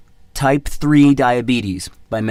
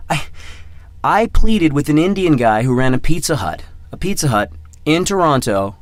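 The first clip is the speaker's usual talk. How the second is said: normal talking voice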